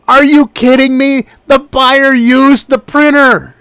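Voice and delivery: loud, high, slowing on the printer line; a furious, incredulous shout; outraged disbelief. Real, not acted. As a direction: blow up at the unfair ruling. Outraged at eBay's refund ruling.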